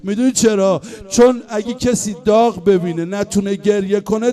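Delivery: usual speaking voice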